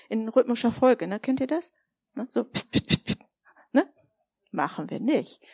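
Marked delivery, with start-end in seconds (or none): 2.3-3.2 pst pst as light slap sounds; playful imitated slaps; mock slap noises. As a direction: tap out the rhythm with pst.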